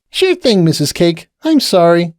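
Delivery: very high, bubbly apology; chirpy young pony saying sorry; young pony to Missus Cake, high and sheepish; Pinkie apologizing. Acted.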